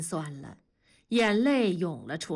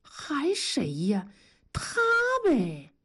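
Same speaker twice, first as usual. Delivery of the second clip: slow, drawn-out insinuation; knowing hint; 6 semitones higher, slower than usual. A narrator's reading. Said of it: "庄嫂一使眼色说".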